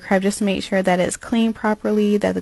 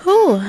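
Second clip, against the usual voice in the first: tired whew; 11 semitones higher, 7 dB louder than usual. Real, not acted. Long walk across airport.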